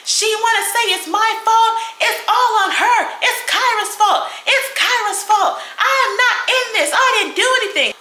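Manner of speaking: high, whiny mock protest; mocking Big B's whiny protest. Mocking Big B as she protests she's not to blame, high and whiny. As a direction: imitate her crying out that it's not her fault. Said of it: "she get to going off".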